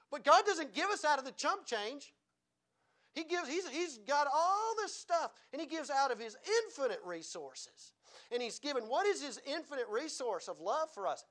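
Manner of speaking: preaching. Real, not acted. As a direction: preach it big, stretching the key words. Preaching with rising energy.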